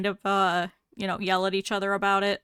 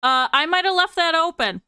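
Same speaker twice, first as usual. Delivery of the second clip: guilty bee; 8 semitones higher, 5 dB louder than usual. Acted. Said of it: "the other one turns ... and is like".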